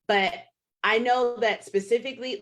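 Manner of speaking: usual speaking voice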